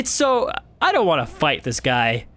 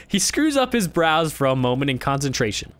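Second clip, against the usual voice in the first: ordinary delivery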